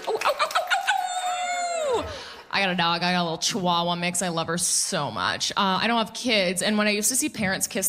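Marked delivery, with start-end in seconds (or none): 0.1-2.1 very high, slow excited squeals. Real, not acted. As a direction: squeal with delight at the dogs. Excited about dogs.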